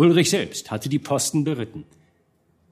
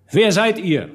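high, raised challenge; bold call; 7 semitones higher, 4 dB louder than usual. A narrator's reading. One of the boldest burghers challenges the speaker, calling out. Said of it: "rief einer der Kühnsten".

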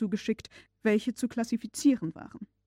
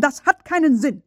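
furious shout, louder and higher; 6 semitones higher, 10 dB louder than usual. A narrator's reading. Uncle failing to decode the parchment, furious shout. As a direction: bellow it in frustration. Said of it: "schrie mein Oheim".

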